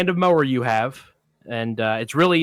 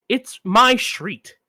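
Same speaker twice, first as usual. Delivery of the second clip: dawning realization; 7 semitones higher, 4 dB louder, much slower than usual. Retold or imitated. Realizing address is his street.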